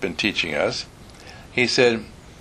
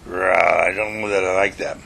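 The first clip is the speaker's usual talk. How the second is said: growling dog; 4 semitones lower than usual. Acted. Dog talks back to the man who claims to be master, growling. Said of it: "I know you're growling".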